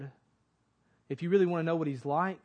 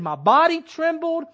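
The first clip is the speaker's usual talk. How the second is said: trembling cry; 10 semitones higher, 9 dB louder than usual. Acted. Habakkuk's fear, acted quote.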